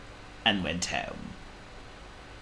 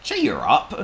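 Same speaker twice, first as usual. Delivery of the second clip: lightly voiced pig, higher and louder; Oink; 8 semitones higher, 6 dB louder than usual. A narrator's reading. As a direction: cheer someone up as a pig. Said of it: "said Oink".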